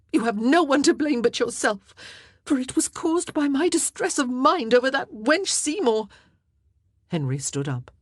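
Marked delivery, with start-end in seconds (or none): 0.1-6.1 high, loud, accusing; angry blame. A narrator's reading. Wife blames husband and Seymour, angry and loud. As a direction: lash out at him.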